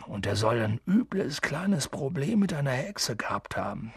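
lightly voiced big man, musing; sympathetic, softening gamekeeper chat; gossiping gamekeeper. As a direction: share a rumour in an easy undertone. Hagrid gossips about Quirrell.

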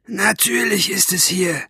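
high, rough villain's voice; rough cat-tongue voice. A narrator's reading. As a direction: rasp it like a cat's tongue. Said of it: "Rau war sie, wie eine Katzenzunge". (raue, hohe Katzenzungenstimme)

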